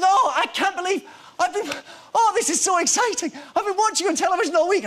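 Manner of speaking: put-on very high, loud gushing old man, a little fast; excited old fan. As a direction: gush like a thrilled old fan. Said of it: "And he says".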